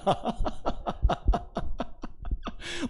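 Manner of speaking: long real belly laugh; amused laugh. Real, not acted. Pastor amused by the absurd contractor, long helpless laugh. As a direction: burst out laughing at your story. Amused by his own story.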